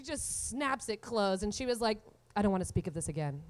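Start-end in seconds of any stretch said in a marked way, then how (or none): 2.4-3.4 low, fast, clipped-off mom line; mom shutting down. Retold or imitated. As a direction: brush it off fast and low like a prim mother.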